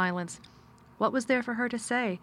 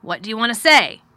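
louder curt master asking; gruff man voice; 2 semitones higher, 11 dB louder than usual. Acted. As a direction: ask it with cool distance. Mr Craven speaks.

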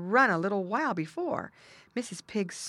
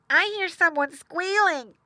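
put-on high, loud young pig voice; boy pig; 13 semitones higher, 7 dB louder than usual. A narrator's reading. Eldest boy pig figure speaks.

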